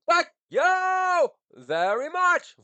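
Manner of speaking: very high, slow, shouted mock gratitude; high slow sarcastic shout; mock grand thank-you. Real, not acted. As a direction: belt it like a diva's sarcastic bow.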